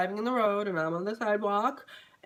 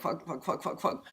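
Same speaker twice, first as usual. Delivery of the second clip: softer, stuttered repeats on trembling lips; scared quivering lips; 3 semitones lower, 7 dB quieter than usual. Retold or imitated. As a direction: imitate lips quivering with fear. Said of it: "when you're scared and your lips like".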